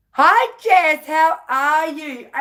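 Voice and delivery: loud, high hello with a drawn-out finish; excited greeting. Real, not acted. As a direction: call out to a friend who just joined, stretching the words. Greets a viewer joining live.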